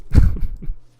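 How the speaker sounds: short, loud burst of laughter; real laugh. Real, not acted. Streamer amused by the game's dialogue, loud laugh.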